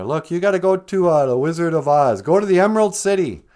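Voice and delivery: loud, high-pitched urging; insistent Munchkins. Acted. Munchkins telling Dorothy where to go, loud and insistent. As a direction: send her off with big mock urgency.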